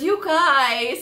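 high, loud you guys; touched delight. Retold or imitated. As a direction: gush at the pranksters. Boss touched by the prank.

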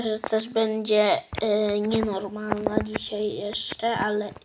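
ordinary talk

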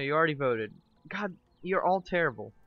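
normal talking voice

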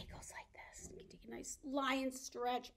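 ordinary talk, nothing marked

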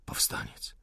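soft, near-whispered reply; weak wounded answer. A narrator's reading. Wounded insurgent answers the officer, weakly. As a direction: answer faintly, voice almost gone.

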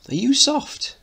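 mock him like a street kid; mocking teen lad. Mickey scoffs at Edward, high and scornful.

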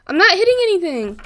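loud, high-pitched protest; exasperated protest. Real, not acted. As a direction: insist it loudly, pitch jumping up. Protesting as the game misbehaves.

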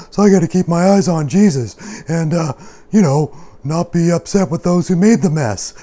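high, loud, lively puppet voice; chatty puppet. Pretend. Puppet grumbles about Rexy's messy dishes, high lively voice. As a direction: pitch it up and chatter playfully.

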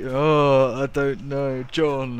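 teasing imitation of a clueless guesser; mock cluelessness. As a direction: play up not knowing, loosely mocking. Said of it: "You were just like".

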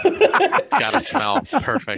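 slow, high, laughing mock praise; sarcastic sniff of approval; mock delight. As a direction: drawl it out like it's a compliment. Joking reply about smelly hat.

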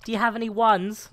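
high, loud begging; mock beggar. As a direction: parody a picky beggar asking for change. Mimics the picky beggar.

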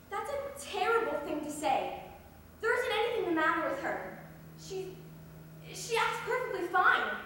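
loud, high protest building in force; indignant defence. Acted. Character defends her mother, upset.

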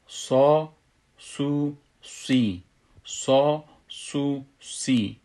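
heavy ṣād in صا, سو, سی, one syllable at a time; letter drill with short vowels. Shown as a demonstration. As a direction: chant صا, سو, سی as separate syllables. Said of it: "گفته بودیم که".

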